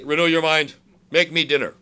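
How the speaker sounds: loud, bossy demand; mock demand. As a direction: bark it out like a spoiled spouse. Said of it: "you could just say".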